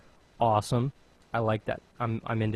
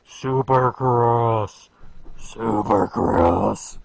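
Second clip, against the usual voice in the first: slow, loud, raspy announcer call; 8 dB louder, much slower than usual. Shown as a demonstration. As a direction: stretch it out in a gravel voice. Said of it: "you need to be raspy and deep".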